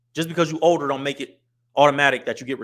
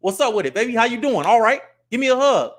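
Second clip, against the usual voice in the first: loud, high-pitched hearty greeting; big, high, louder hello, going in for a hug; charismatic public greeting; 8 semitones higher, 6 dB louder than usual. Acted. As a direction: greet a fan at the gas station. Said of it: "when y'all catch me at the gas station".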